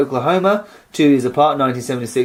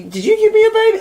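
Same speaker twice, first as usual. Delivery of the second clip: high pleading ask. Retold or imitated. Asking strangers in dream.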